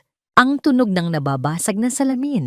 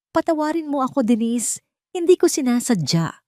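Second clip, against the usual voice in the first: higher, pleading through tears; weeping plea; 7 semitones higher than usual. A narrator's reading. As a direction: beg the ghost while crying. (umiiyak na nakikiusap sa multo)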